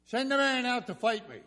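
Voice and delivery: high, loud taunting call; yelled challenge. Retold or imitated. Goliath challenging the Israelites, yelled across. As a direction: yell it over to the enemy army. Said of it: "he would yell over at the Israelites".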